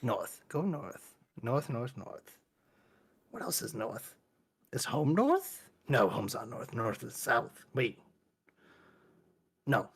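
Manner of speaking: soft, dazed mumble to himself; quiet muttering. Acted. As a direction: murmur it like a lost creature.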